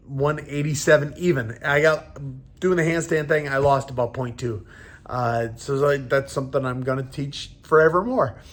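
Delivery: ordinary talk, nothing marked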